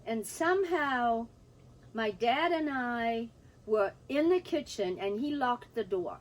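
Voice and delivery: ordinary delivery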